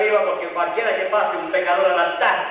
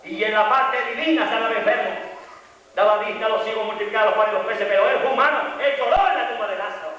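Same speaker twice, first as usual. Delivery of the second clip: ordinary talk, nothing marked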